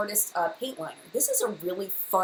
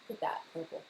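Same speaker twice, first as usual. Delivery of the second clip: ordinary delivery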